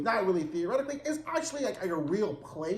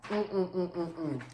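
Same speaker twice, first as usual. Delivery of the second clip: disapproving hum; 5 dB quieter than usual. Retold or imitated. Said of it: "I was just like".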